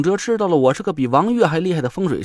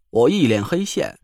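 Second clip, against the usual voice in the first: usual speaking voice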